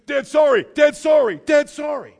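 put-on loud, high kid's "Dad, sorry"; child's hurried apology. Little kid apologizing to dad, loud and high. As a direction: blurt it like a caught child. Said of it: "just like my little kids".

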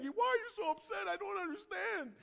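high-pitched imitation of a bawling child; mock bawling. Son bawling at his upset dad, mock tearful wail. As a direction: wail it like an upset little kid. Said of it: "he starts bawling".

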